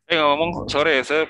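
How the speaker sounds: muffled by a mask; through a face mask. Real, not acted. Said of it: "masih harus pakai masker".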